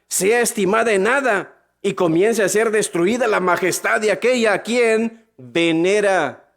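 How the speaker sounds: lifted preacher's scripture voice; scripture reading. Acted. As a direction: read the verse aloud to the crowd. Reads Bible verse aloud.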